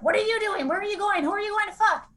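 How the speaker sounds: high-pitched retold jealous outburst; imitated accusing boyfriend. Jealous boyfriend questions her as she leaves, imitated high-pitched accusation.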